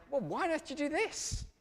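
high-pitched, baffled questioning; mock disbelief, pitched right up; incredulous friend. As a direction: imitate a friend who cannot believe it. Acted friend's incredulous reaction to invitation.

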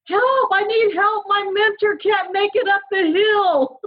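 very high, drawn-out mock cry; hammed-up child's distress call; kid yelling out. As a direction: wail it slowly like a child calling everyone. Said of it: "he yells out".

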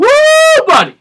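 very high, loud whoop; whooping cheer. Retold or imitated. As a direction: whoop like a crowd going wild. Retold cheering in theater.

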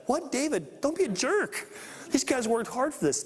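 high-pitched mock outrage; hammed-up indignant complaint; indignant protest. Acting indignant reaction to David.

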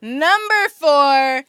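drawn-out game show announcement; slow dramatic reveal. Acted. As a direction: stretch every word for suspense.

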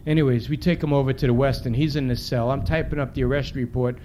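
usual speaking voice

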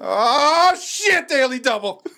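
very high, stretched exclamation; mock alarm. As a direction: shout it in pretend panic. Acting shouted game show alarm.

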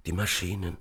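soft, feeble dying voice; faint, faltering words; weak stammer. A narrator's reading. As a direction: gasp it out with fading strength. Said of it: "Seine Stimme klang kraftlos".

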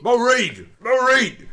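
high, insistent comic call. Acted. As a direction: call Maureen over from afar. Calling out to Maureen in sketch.

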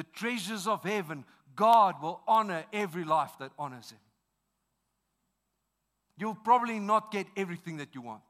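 rally the congregation to commit; preaching at full force. Acted. Preacher builds altar call.

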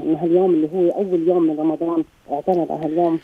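ordinary delivery

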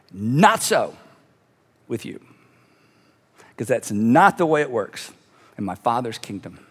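low, hushed, with long pauses; solemn correction. Acted. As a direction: bring it right down and let each phrase land. Jesus solemnly corrects the disciples.

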